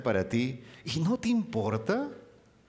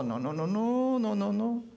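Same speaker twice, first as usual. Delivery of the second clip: tender diminutives; 7 semitones higher, slower than usual. Acted. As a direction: call each name fondly. God's gentle correction voiced. (Cristo llamando con cariño a sus hijos)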